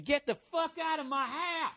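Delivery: high mock yell, held back in volume. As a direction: imitate an enraged movie character throwing someone out.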